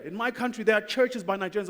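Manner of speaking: ordinary delivery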